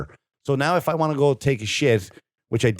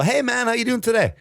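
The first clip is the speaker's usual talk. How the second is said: friendly greeting; 11 semitones higher than usual. Retold or imitated. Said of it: "And I go".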